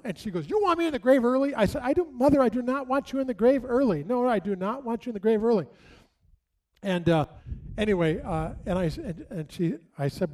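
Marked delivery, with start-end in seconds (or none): 0.5-1.5 indignant mother. Retold or imitated. Mother indignant at funeral talk. 1.8-5.7 flustered backpedal. Retold or imitated. Son hastily backpedaling to mother.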